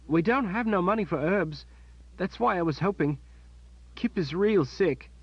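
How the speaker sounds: put-on a poor boy hanging his head; downcast boy. Boy telling Kalen they have no money, sad.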